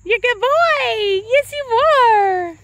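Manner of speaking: high, slow, drawn-out praise for a dog. Real, not acted. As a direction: pitch way up, stretching the last words. Praises dog in baby talk.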